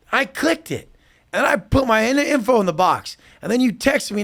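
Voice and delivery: high, loud whiny voice playing the confused caller; mock him as a clueless whiner; mocking dumb client. Coach mocking a confused real estate client, in a high whiny voice.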